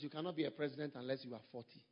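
normal talking voice

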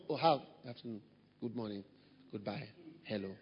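soft, fading, emotionless greetings; quiet icy pleasantries; cold flat greetings. Retold or imitated. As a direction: greet him like he means nothing to you. Mimics cold greetings again.

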